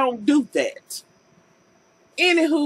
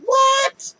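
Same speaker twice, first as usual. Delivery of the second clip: high, loud, disbelieving shout; shocked what; 11 semitones higher, 3 dB louder than usual. Real, not acted. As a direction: yelp out the confusion at the screen. Shocked reacting to TV scene.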